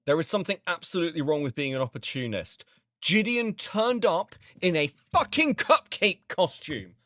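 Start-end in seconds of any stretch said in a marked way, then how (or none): 3.0-7.0 incredulous. Real, not acted. Incredulous at the cupcake costume.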